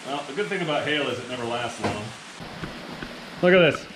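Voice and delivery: usual speaking voice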